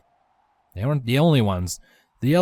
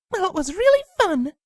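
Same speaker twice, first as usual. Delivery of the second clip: high mother voice. Acted. Windy voiced.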